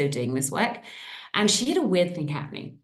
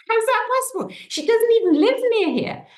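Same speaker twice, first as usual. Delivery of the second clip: disbelief; 6 dB louder than usual. Real, not acted. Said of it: "disbelief at the phone call".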